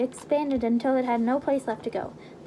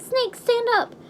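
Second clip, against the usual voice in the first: shouting; 11 semitones higher, 5 dB louder, slower than usual. Acted. Urging a dying friend.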